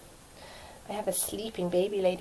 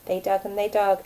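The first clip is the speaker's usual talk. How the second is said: ordinary delivery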